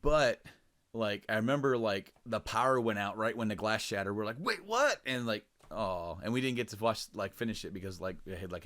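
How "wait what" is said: high-pitched, startled "huh?" reaction; startled disbelief. Retold or imitated. Friends react to the power going out, startled. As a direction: blurt it out, much higher, in disbelief.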